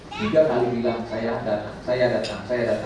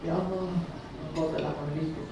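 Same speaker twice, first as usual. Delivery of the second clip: soft prayer; 3 semitones higher, 6 dB quieter than usual. Shown as a demonstration.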